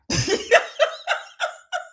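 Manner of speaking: high, airy wheeze of a laugh; breathless laugh. Real, not acted. Host laughing at the chat, wheezing laugh.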